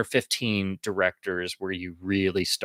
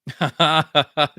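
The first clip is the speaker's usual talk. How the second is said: big real laugh; burst of laughter; 7 semitones higher, 7 dB louder than usual. Real, not acted. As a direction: laugh out loud. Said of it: "laughing before trailer voice".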